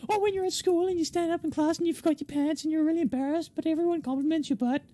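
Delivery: high little voice, rattling off odd nightmares; chirpy newcomer witch. Acted. New witch tells the security lads her favourite nightmares, high-pitched and chatty.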